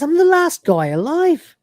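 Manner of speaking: high pleading Lister voice; mock desperate plea, pitched high. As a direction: squeak it out like a last desperate gambit.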